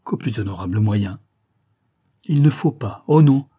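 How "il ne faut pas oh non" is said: higher, louder protest; emphatic plea. A narrator's reading. Daughter refusing to let the old banker live on, impassioned insistence. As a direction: insist fervently, swelling on the refusal. Daughter rejects her father's former identity.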